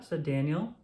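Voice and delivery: ordinary delivery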